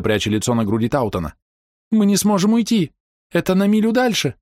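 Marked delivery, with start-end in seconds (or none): 1.9-4.3 very high, panicked cry; terrified scream. A narrator's reading. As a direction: cry out in panic, much higher. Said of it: "закричала Ида".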